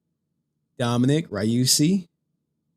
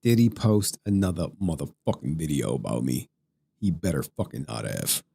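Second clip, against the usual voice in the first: much lower and softer, mock threat; deep menacing voice; 7 semitones lower, 4 dB quieter than usual. Streamer joking about a rival's video, deep mock menace. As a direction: growl it low like a tough guy. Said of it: "what if I talk like that?".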